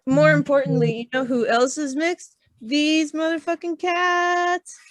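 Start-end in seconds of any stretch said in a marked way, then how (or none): 2.6-4.8 exasperated. Acted. Exasperated punchline about cats.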